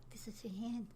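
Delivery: soft unvoiced whisper. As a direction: breathe the words without voice. Whispering the husband's words.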